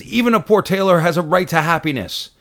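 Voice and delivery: a lightly voiced Fiddler tailor making his stand; tailor's plea. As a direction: push up and out like a timid man finally speaking up. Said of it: "finally he says".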